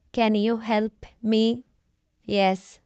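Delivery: ordinary delivery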